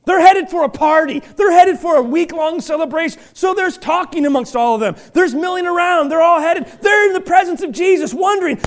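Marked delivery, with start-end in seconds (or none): none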